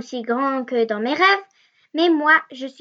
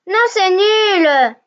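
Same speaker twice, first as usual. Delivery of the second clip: slow, louder protest; yelling; 4 semitones higher, 5 dB louder, slower than usual. A narrator's reading. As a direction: fling back the refusal. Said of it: "hurle la petite fille". (une protestation lente et plus forte)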